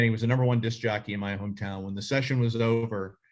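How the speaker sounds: ordinary talk, nothing marked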